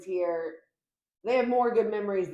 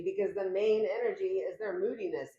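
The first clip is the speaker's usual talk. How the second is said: ordinary talk, nothing marked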